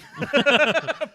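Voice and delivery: loud high laugh; high burst of laughter. Real, not acted. Laughs at own joke.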